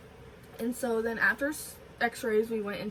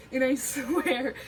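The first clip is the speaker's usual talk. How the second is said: laughing; 3 semitones higher, 5 dB louder, slower than usual. Real, not acted. Laughing retelling embarrassing cup story.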